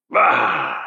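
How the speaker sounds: put-on loud slurred drunken outburst; drunk angry. A narrator's reading. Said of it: "slurred out in an angry, belligerent voice".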